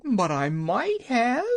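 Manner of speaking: high, slow tearful exclaim. A narrator's reading. Woman exclaims to the Wizard before crying. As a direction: insist it on the edge of tears. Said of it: "she exclaimed".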